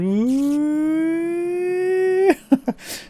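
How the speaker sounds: a long, high wail; imitated scared child's scream. Boy who threw the rock, scared: imitated screaming cry. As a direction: mimic a frightened little boy crying out. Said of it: "he starts screaming 'cause he's scared".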